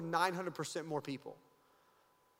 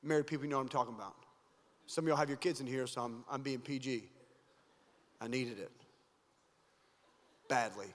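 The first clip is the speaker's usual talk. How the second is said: sheepish aside; 8 semitones lower, 5 dB quieter, faster than usual. Real, not acted. Preacher confides sheepishly.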